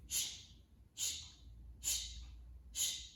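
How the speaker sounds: long whispered shh; shushing. Shown as a demonstration. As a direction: hush the noisy walks. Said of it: "help me do this".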